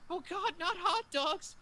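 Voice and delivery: high, drawn-out mock horror; imitating horrified man. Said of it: "he's just sitting there horrified".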